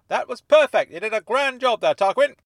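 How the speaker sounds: high, very loud congratulation; delighted praise. Acted. Dennis praising Tarquin after the meeting, loud and delighted. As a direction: praise him heartily at full voice.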